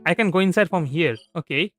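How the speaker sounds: ordinary talk, nothing marked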